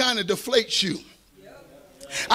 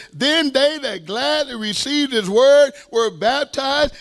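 slow, ringing Bible reading; measured, weighty pulpit reading; emphatic scripture reading; 3 semitones higher, 3 dB louder, slower than usual. Acted. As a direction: proclaim the verse word by word. Reads the verse aloud to congregation.

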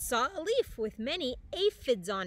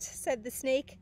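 usual speaking voice

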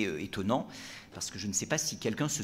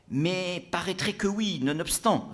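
pompous brigadier, raised high; gendarme Collet; 9 semitones higher, 4 dB louder than usual. Acted. Brigadier answering the tavern drinkers, pompous. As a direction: play the self-important gendarme. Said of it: "répondit Collet".

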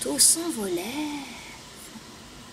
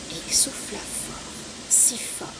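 whispered, building suspense; 2 semitones lower, 6 dB quieter than usual. A narrator's reading. Storyteller whispering to the children as the wind blows. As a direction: hush it like a secret for the kids.

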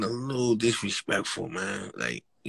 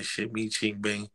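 ordinary talk, nothing marked

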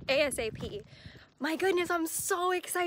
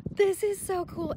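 higher, louder, slow delighted; excited; 5 semitones higher, 4 dB louder than usual. Real, not acted. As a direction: savour it, delighted. Unboxing a gorgeous book.

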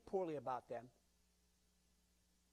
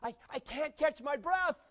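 raised, panting complaint of a winded man; breathless runner; 9 semitones higher, 6 dB louder than usual. Acted. Preacher's joke, the runner gasping to his friend. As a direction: gasp it out like you just ran hard. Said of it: "the fellow who said to his friend".

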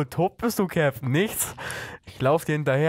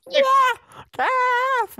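high squeal; 3 dB louder than usual. Real, not acted. In-game chaos.

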